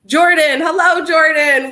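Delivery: welcoming a viewer into the live stream, high and loud; excited greeting. Real, not acted. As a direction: sing out a hello to a friend who just arrived.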